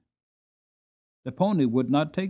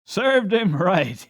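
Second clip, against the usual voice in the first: served him right; 5 semitones higher, much slower than usual. Acted. John mocks the fallen boy.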